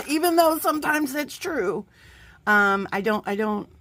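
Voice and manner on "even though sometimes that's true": high, bright laugh into the admission; real laugh, pitch jumps up. Real, not acted. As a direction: laugh it off, a bit embarrassed. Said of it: "think all I do is cry".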